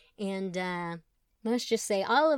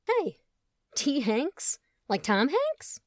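surprised; 6 semitones higher, much slower than usual. Acted. Tom delighted realization.

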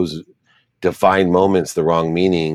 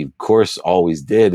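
ordinary delivery

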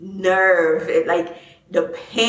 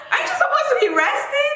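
high, fast, laughing scold; laughing disbelief; faster than usual. Retold or imitated. Host scolds friend for partying, laughing high. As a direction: tease her through laughter. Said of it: "I was like".

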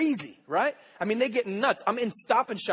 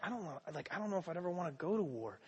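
inner thought; 12 dB quieter, faster than usual. Retold or imitated. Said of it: "I'm thinking in the back of my mind".

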